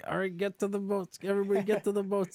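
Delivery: high, loud order to evacuate; rally everyone to the boats at full voice; urgent call. Retold or imitated.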